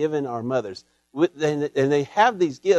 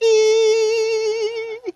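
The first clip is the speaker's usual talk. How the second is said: stretched, much higher, slow drawn-out call of the E; imitating a mother's drawn-out dinner call; mom's long call. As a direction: hold the E like a mother calling across the block. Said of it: "That E just goes all over the place".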